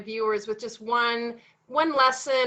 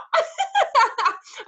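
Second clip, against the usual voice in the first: laugh; 12 semitones higher, 3 dB louder than usual. Real, not acted. Laughing at her own panic.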